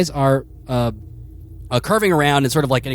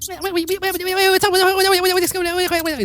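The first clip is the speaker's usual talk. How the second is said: very high squeaky rat voice; a tiny panicked rat; frantic rat; faster than usual. Acted.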